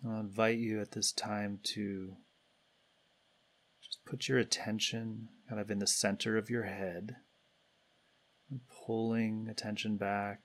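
calm, unhurried guidance with long pauses between phrases; guided meditation. Real, not acted. Meditation leader guiding everybody to turn attention inward, calm and spacious. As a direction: lead the group gently inward, leaving space after each phrase.

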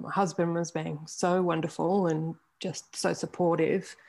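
ordinary delivery

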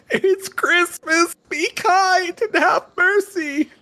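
very high, slow, hammed-up sobbing plea; crying terrorist. The wounded terrorist begs the players, high pleading wail. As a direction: beg for mercy in a squeaky pleading voice. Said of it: "all of a sudden he's crying".

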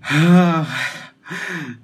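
a long, audible sigh before answering; a loud real sigh, stumped by the question; heavy sigh. Real, not acted. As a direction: let out a weary breath at the tough question. Weighing a hard question.

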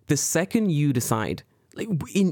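usual speaking voice

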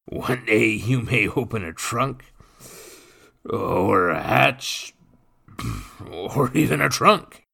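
high, loud boozy mockery; loud drunken brute teasing the boy; drunken brute mocking. A narrator's reading. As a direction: tease him like a drunk lout. Nathan's mock advice, voiced.

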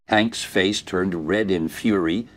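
ordinary delivery

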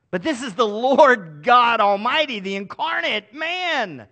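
loud, rising sermon proclamation; booming preacher's declaration; preaching at full force. Acted. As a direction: proclaim the Lord's authority from the pulpit. Preaching builds to a climax.

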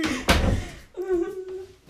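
bursts out laughing. Real, not acted. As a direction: giggle of disgust. Laughing at wrappers.

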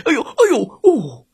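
high-pitched startled cries; frightened yelp. A narrator's reading. Wolf startled by the horn, high frightened yelping. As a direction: yelp as if the noise terrifies you. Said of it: "这可吓坏了大嘴狼".

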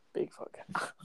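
very soft, voiceless whisper; hushed whisper to yourself; whispered echo. Real, not acted. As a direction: whisper it under your breath. Echoes the challenge under his breath.

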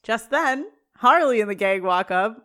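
usual speaking voice

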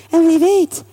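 a light sigh of hope. A narrator's reading. Mother wondering about daughters' future, lightly sighing. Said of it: "met een zucht".

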